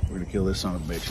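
ordinary talk, nothing marked

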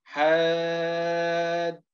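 حاد chanted very slowly with the alif held long; slow spelled-out drill. Shown as a demonstration. Teacher spelling the word aloud for trainees, drawn-out chant. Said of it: "نتهجى مثل هذه الكلمة هكذا".

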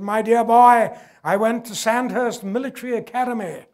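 imitating a Bangladeshi general's plummy British English; posh British accent. A lecturer imitates the general's British accent. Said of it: "very British voice".

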